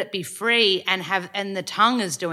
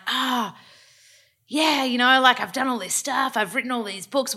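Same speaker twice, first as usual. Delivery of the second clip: higher-pitched mock bragging; playful boast, pitched up; mock self-promotion; 6 semitones higher than usual. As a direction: imitate someone talking themselves up. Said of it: "talk ourselves up or cheerlead ourselves".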